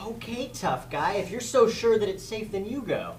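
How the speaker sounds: ordinary delivery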